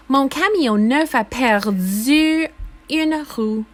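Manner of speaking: plain reading voice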